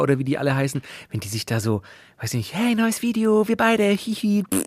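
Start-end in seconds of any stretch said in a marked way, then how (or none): 2.5-4.4 influencer couple voice. Retold or imitated. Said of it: "Bibi, Dagi, Bobo, Bayer".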